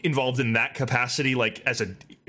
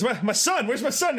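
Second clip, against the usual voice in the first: frantic father; 8 semitones higher than usual. Retold or imitated. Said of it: "He goes".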